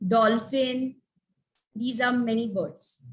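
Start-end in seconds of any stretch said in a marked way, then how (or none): none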